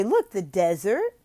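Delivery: slow, simple explaining; very simple slow explanation. Shown as a demonstration. Tutor models a simple explanation, slowly. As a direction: spell it out slowly for a child. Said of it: "You can say".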